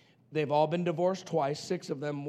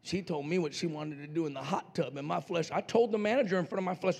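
ordinary talk

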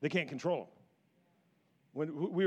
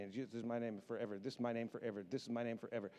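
much softer, reverent repetition; hushed solemn reading; 6 semitones lower, 13 dB quieter, faster than usual. Acted. Pastor quietly repeating God's name promise from scripture, hushed and reverent. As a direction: drop the voice and savour each repeat.

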